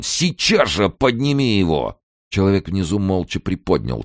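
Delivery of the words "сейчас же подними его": higher, forceful command; angry yelled order. A narrator's reading. Kern ordering the body lifted, angry yell. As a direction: demand instant obedience. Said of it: "орал он".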